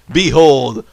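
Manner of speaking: loud, slow, hammed-up herald; mock-grand proclamation. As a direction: boom it out like a mock prophecy. Proclaims a miracle in play.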